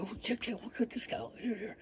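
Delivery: whispered, high and soft, secretive. Retold or imitated. Coworker secretly showing the monitoring list, whispered. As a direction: lean in and whisper so nobody hears.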